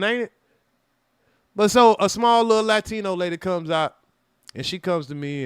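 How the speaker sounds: ordinary talk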